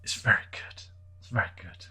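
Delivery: whispered praise; hushed whisper about the bass. Real, not acted. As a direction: breathe it out softly.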